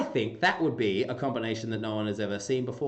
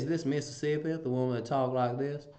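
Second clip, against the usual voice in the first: lightly put-on Mississippi accent; a hint of Mississippi twang; Southern drawl; 4 semitones higher than usual. Shown as a demonstration. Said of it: "I quite like the Southern drawl".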